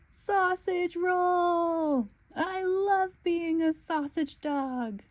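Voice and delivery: slow, high, drawn-out whoop; joyful cry. Acted. Snickers rolling down the hill, slow high joyful whoop. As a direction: let it ring out as you roll.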